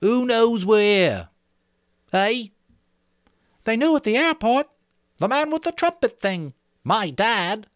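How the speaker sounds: Piggy tells Jack he's getting in Ralph's way, high and insistent. Acted. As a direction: scold them for interrupting. Piggy addresses the meeting.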